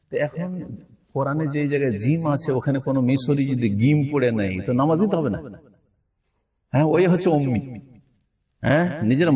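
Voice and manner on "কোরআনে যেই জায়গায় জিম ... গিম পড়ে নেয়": usual speaking voice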